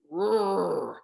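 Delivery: Rrr said with puckered lips; lips pursed. Shown as a demonstration. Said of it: "gotta pucker your lips like this".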